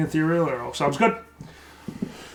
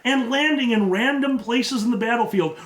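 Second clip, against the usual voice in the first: higher-pitched, building game master narration; excited scene setting; 6 semitones higher than usual. Acted. Game master describes explosions over the battlefield, excited high narration. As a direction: narrate the chaos with mounting excitement and laughter.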